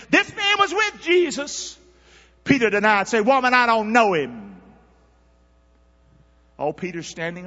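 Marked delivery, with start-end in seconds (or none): 0.1-1.8 put-on high, slow accusing servant girl; accusing maid. Maid accusing Peter by the fire, high and slow. Maid's voice in scripture. 2.4-4.6 loud, high preaching read; preaching scripture. Acted. Preacher reading Peter's denial to church, loud.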